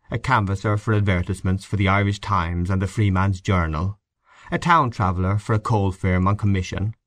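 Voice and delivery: ordinary delivery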